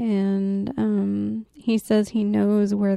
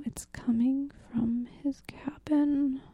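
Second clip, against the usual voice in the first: spooky whisper; 3 semitones higher, 9 dB quieter than usual. Acted. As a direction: whisper it like a campfire tale. Spooky climax of ghost story.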